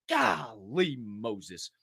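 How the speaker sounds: ordinary delivery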